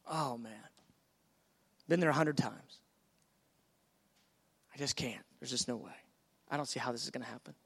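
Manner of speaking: a deflated, beaten-down murmur; defeated sigh. Acted. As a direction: sound like you've given up before starting. Believer voiced doubting.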